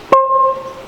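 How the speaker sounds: very high chirped beep like a navigator reroute; high GPS beep. Retold or imitated. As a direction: make a little high electronic ping. GPS sound effect.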